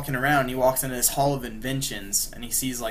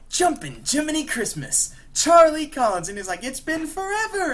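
high, loud gleeful greeting; hammed-up Joker delight; a much higher, excited Joker cheer; 5 dB louder than usual. Joker greets old buddy.

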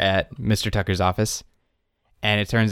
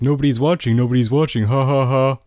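mocking reporters; 4 semitones higher, 4 dB louder than usual. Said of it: "the reporters will be like".

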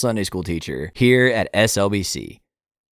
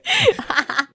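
giggle. Real, not acted. Laughing at the accent.

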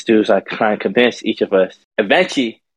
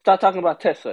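higher, mock-indignant quote; higher-pitched imitation of an annoyed guy; imitating an irritated man; 7 semitones higher, faster than usual. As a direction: retell his touchy objection, pitched up. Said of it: "he gets even more angry".

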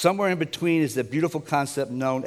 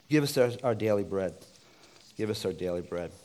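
soft tearful plea; 5 semitones lower, 5 dB quieter than usual. Retold or imitated. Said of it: "people crying, going".

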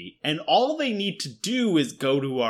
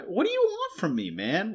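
pitched-up, fed-up protest; exasperated plea; 5 semitones higher, 4 dB quieter than usual. Retold or imitated. Radiologist griping at a doctor's bad photos, exasperated. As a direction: throw up your hands at them. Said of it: "and you're like".

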